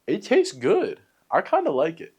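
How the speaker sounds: high, loud burst of real delight; genuinely thrilled by a new taste; pleasantly surprised. Real, not acted. Surprised delight at kiwi skin.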